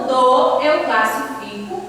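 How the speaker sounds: ordinary talk, nothing marked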